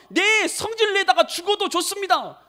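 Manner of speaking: high, defiant outburst; angry Jonah. Acted. Jonah answers God's rebuke in a temper, shouting angrily. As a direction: fling back a heated retort. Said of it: "화내고 성질 내면서".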